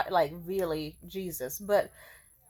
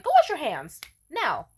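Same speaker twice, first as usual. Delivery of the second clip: bossy command; 5 semitones higher, 6 dB louder than usual. Acted. Pretty woman dominating a man, bossy command. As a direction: order him about, pitched up and louder. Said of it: "pretty woman being like".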